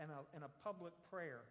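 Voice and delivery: ordinary talk, nothing marked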